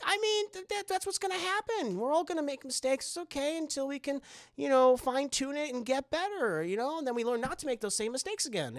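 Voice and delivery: high, gentle comforting voice; reassuring mom. Retold or imitated. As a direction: soothe the kid in a lifted, soft tone. Vera reassuring Esther.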